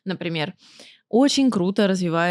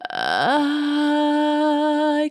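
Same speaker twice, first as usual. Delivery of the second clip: thin head voice; 6 semitones higher than usual. Shown as a demonstration. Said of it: "какое-то более тонкое".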